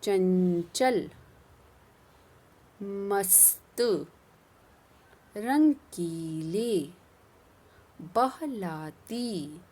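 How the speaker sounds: each word stretched very slowly; slow drill. Shown as a demonstration. Teacher drills the new words slowly with pupils. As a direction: pronounce every word slowly for repeating. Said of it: "फिर से करते हैं".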